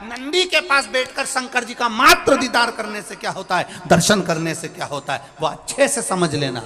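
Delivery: high, loud sermon at full force; preacher rousing listeners against mockers; fervent preaching. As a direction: drive the point home to the crowd.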